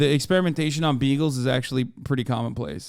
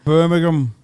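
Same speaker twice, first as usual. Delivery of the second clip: mock Brummie accent, a bit louder; Birmingham accent; 3 semitones higher, 6 dB louder than usual.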